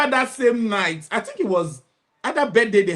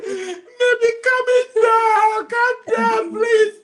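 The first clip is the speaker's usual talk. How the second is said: high, slow, laughing plea; laughing calm-down; 14 semitones higher, 3 dB louder, much slower than usual. Retold or imitated. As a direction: beg through the laughter. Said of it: "I said".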